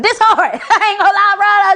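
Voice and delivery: loud and high through real laughter; cracking up with delight; laughing. Real, not acted. Laughing at the lollipop.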